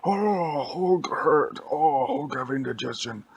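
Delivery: loud, high groaning monster voice; Hulk. Acted. Hulk groans after missiles blew up inside him, pained. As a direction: moan like a hurt cartoon brute. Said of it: "And he's like".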